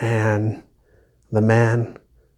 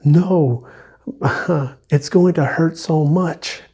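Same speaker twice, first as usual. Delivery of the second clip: mildly acted frightened ghost, pitched higher; mock-anxious plea in a raised voice; retold fearful protest; 5 semitones higher than usual. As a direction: imitate a scared ghost pleading, voice lifted. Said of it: "the ghost is fearful".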